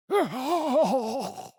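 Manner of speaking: shrill cackling laugh up high; giddy high-pitched laugh; high burst of laughter. Acted. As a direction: let a high wild laugh out.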